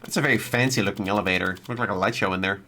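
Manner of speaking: usual speaking voice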